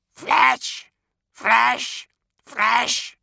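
high loud squawking crow voice; crow calls. Acted. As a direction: caw it like a hungry crow. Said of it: "the crows called".

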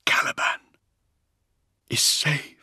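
dying man's halting whisper; faint, broken-up final whisper; barely a whisper. A narrator's reading. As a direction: whisper it as your last words. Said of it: "his voice barely a whisper".

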